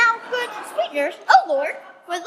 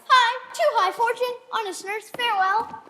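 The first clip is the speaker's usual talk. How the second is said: joyful; 6 semitones higher than usual. Acted. Juliet joyfully rushing off.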